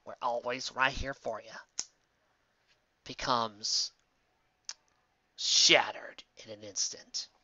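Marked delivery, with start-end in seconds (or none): 3.0-7.3 trailer narration. Acted. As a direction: breathe it like a movie trailer.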